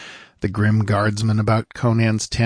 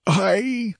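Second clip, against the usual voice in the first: high, grieving answer; understated sob in a raised pitch; sobbed; 10 semitones higher than usual. A narrator's reading. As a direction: answer as if near tears. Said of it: "sobbed the squire".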